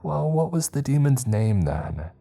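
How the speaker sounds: high, teasing challenge; smirking. A narrator's reading. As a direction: needle him with a smirk. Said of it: "Mary smirked".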